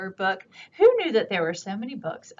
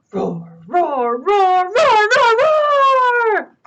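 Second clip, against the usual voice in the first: building dinosaur roar; 11 semitones higher, 7 dB louder, much slower than usual. Acted. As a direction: build the roars bigger and higher. Dinosaur roars at owl.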